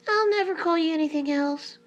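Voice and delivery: high-pitched scared hen; frightened promise. A narrator's reading. Hen promises the fierce peacock, high and afraid. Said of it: "who was afraid".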